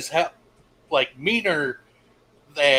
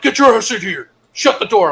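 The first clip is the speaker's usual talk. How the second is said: higher, louder gruff order; mock drill-instructor bark; 7 semitones higher, 7 dB louder than usual. Instructor ordering the recruit into his office, mock bark.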